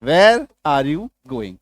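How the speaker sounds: very slow, each word separated; slow demo. Shown as a demonstration. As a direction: lay it out slowly for the class. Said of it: "ये देखिए". (धीरे-धीरे समझाते हुए)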